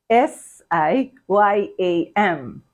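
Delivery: slow letter by letter; S I Y A M spelled slowly, letter by letter; spelling out. Shown as a demonstration. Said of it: "You spell siyam as".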